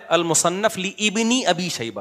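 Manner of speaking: لابن drawn out as "li-ibni", hamza kept; imitated misreading. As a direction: mimic the over-careful split "li-ibni" in لابن. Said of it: "لوگ اس کو غلط پڑھتے ہیں". (لابن میں الف بول کر "لِ اِبنی"، ٹھہر ٹھہر کر)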